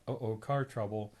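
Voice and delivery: usual speaking voice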